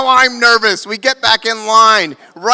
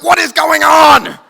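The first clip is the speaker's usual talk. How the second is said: bewildered shout; 7 semitones higher, 5 dB louder than usual. Retold or imitated. Stunned in the club.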